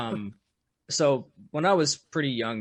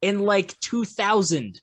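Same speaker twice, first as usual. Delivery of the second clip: high, louder, slowed on two thousand; mock disbelief; 7 semitones higher, 5 dB louder, slower than usual. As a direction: stretch out the year in disbelief. Quoting himself about old computer.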